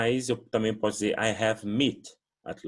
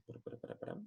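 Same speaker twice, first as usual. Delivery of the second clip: whispered aside, wait wait; whispered to self; 3 semitones higher, 19 dB quieter, much faster than usual. Real, not acted. Teacher mutters to herself mid-lesson, whispering. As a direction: breathe the words to yourself while checking. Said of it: "teacher pauses to check something".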